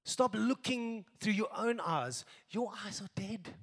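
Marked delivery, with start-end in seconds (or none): 2.5-3.5 whisper. Real, not acted. Preacher drops to a whisper.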